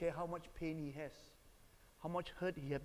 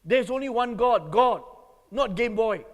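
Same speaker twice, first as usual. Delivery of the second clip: very loud, high angry shouting; reenacted furious outburst; acted-out rage; 7 semitones higher, 17 dB louder than usual. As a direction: thunder at your son like an enraged preacher. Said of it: "I was so filled with rage".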